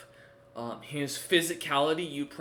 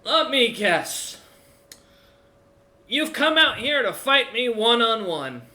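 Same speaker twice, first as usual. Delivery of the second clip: put-on raised, loud and slow, coughing old warlord; old dwarf chieftain; 10 semitones higher, 10 dB louder than usual. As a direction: taunt them like a battered old warrior. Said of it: "and goes".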